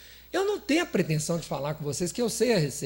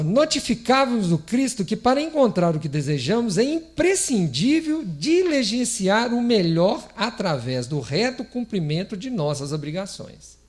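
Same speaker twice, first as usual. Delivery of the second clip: solemn text reading; reading aloud; 5 dB louder than usual. Acted. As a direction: read the scripture with weight. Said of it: "Ele fala aqui no texto assim".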